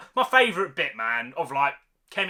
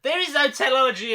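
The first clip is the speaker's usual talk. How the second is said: higher, louder mock-dramatic quote; mocking the characters; 6 semitones higher, 4 dB louder than usual. Reviewer mocking the film's horse scene dialogue, hammy. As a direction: ham up the dramatic line to sneer at it. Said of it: "they're like".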